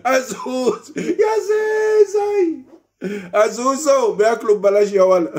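high shrieking mock cry, drawn out; mock outraged shout. Mocking the generals eating free, shouted. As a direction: yell it like a scandalized onlooker.

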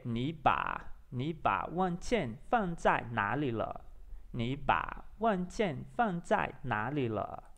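slow, careful Mandarin drill; practising pronunciation. Shown as a demonstration. Learner training before a pronunciation check, slow and careful. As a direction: separate each word, taking your time. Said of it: "eu vou treinar mais um pouco".

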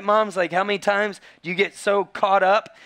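usual speaking voice

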